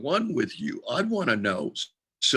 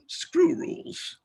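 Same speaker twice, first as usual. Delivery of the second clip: defiant scoff; much slower than usual. Acted. Dismissing tarot rules.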